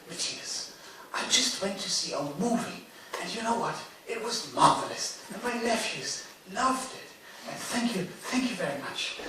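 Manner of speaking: a gently voiced grateful elder; Richard Briers on the phone. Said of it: "he said to me".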